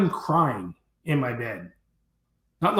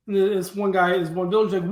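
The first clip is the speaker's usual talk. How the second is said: ordinary talk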